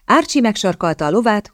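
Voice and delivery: ordinary delivery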